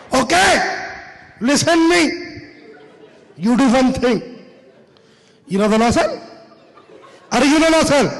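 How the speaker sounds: put-on Pakistani accent. Pakistani clerk takes charge of the room, Pakistani accent.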